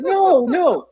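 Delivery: high, laughing mock protest; pleading innocence, hammed up; protesting retold denial. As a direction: deny it to dad, half laughing. Teller denying to dad, retold.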